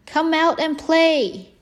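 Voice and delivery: called out high and loud; calling out. A narrator's reading. Snake calling Frog outside to play, loud eager call. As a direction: shout it up to the house, eager. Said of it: "and called".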